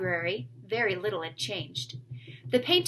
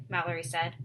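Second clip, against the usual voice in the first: ordinary delivery, nothing marked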